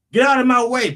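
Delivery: high, loud brush-off; mock bullying yell; acted domineering shout. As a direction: shove them aside with your voice, high and loud. Acts rich trampling the poor.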